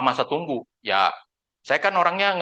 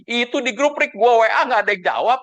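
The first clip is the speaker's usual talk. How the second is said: high, louder imitated grumble; retold complaint; 9 semitones higher, 5 dB louder than usual. Client phoning agent about unanswered group chat, complaining. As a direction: complain as the ignored client, pitch raised.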